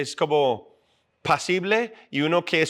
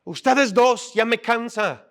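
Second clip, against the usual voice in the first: imitating his mother, higher pitched and stern; scolding mom; 6 semitones higher, 3 dB louder than usual. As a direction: play the fed-up mom ordering the boys to stop. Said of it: "Y mi mamá llega y dice".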